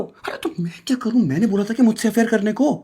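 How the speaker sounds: very high-pitched exasperated retort; high, agitated self-defence; defensive outburst. Acted. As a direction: throw the blame back, voice shooting up. Lover throws the blame back.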